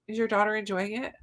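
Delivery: usual speaking voice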